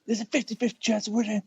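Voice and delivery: high-pitched wrestling promo voice; Scott Steiner promo. Retold or imitated. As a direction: rattle it off as a wrestler cutting a promo. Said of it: "doing the whole Scott Steiner promo".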